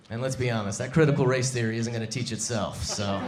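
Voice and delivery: normal talking voice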